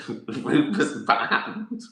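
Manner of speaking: laughing through the words, drawn out; slow, amused delivery breaking into laughter. Real, not acted. Host banning Rupert over a long-nosed monkey, laughing. Laughs while threatening to ban questioner.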